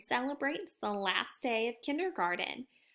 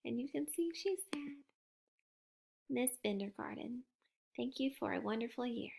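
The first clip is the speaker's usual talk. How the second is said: much softer, sad thanks; 11 dB quieter, faster than usual. Real, not acted. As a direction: hush it down, a bit sad. Reader turns sad, soft.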